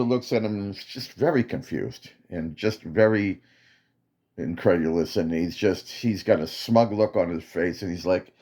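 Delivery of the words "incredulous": ordinary delivery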